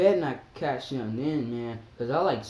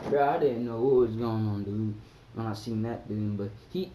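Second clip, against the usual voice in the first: normal talking voice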